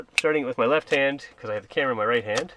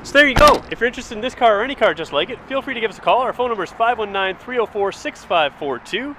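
sales pitch; 6 semitones higher, 6 dB louder than usual. Acted. Closing dealership sales pitch.

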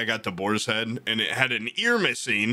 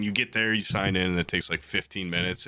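normal talking voice

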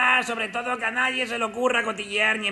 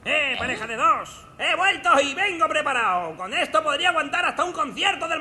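calling out; 7 semitones higher, 4 dB louder than usual. Acted. Hero calling out.

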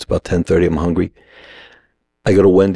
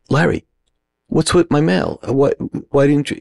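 higher-pitched, halting complaint; retold confrontation; 7 semitones higher than usual. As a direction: confront your roommate in a raised pitch, stumbling over the question. Acted confrontation with roommate over mail.

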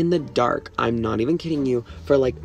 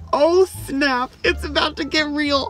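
mock alarm; 13 semitones higher than usual. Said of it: "we're like".

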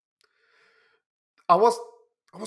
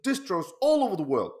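usual speaking voice

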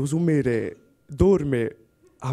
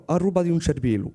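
usual speaking voice

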